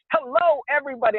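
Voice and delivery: high, showy on-air greeting; mock the host's big radio hello; imitating podcast Eric. Cohost mocking the host's on-air greeting, high and hammed-up. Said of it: "you're like".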